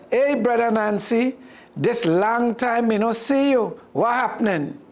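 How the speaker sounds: a hint of a cheerful Mongoose; Mongoose greeting. Mongoose answers Anansi.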